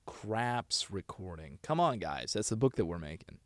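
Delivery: ordinary talk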